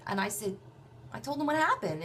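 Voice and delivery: ordinary talk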